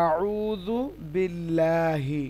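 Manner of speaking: আউযু বিল্লাহি very slow, light L and long open "laa"; thin Lam pronunciation. Shown as a demonstration. Teacher shows the thin example, আউযু বিল্লাহি slow with light Lam. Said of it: "লামটা বারিক".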